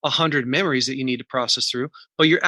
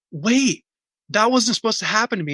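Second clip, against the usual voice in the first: put-on higher, fast, confused protest of a kid; hurt little boy; 7 semitones higher than usual. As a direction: blurt it out like a wounded twelve-year-old. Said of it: "He's like".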